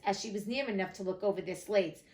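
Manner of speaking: plain reading voice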